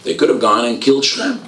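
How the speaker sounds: fervent sermon building to its point; impassioned preaching. Acted. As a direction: preach it to the whole hall. Preaching at full force.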